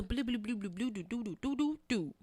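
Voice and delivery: babbled nonsense French imitation; mock foreign gibberish. Imitating the man's French for friends, mock gibberish. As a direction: rattle off nonsense syllables as his French. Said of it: "He's speaking that shit".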